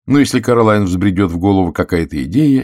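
ordinary delivery

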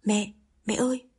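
a mildly alarmed call for mother; panicked call. A narrator's reading. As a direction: burst in calling mom, a touch of panic. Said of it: "Yến hốt hoảng từ ngoài chạy vào".

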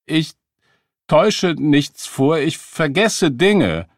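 high, loud, halting stammer. A narrator's reading. Beggar accused of faking stammers his defence, stammering. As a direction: stammer out a slow, strained denial. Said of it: "Der Mann stotterte".

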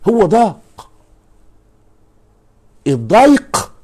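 ضاق and الضيق punched high and loud; stress on ضاق. Shown as a demonstration. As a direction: hit ضاق hard and high, then الضيق rising. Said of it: "شوف عند الضيق قال".

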